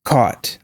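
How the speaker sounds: "caught" with rounded AW, falling; caught, AW vowel. Shown as a demonstration. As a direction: say "caught" as kawt, tune down. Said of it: "repeating four or five times".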